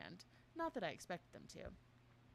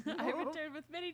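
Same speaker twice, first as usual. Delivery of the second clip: laughing; 8 semitones higher, 9 dB louder than usual. Acted. Retake while laughing.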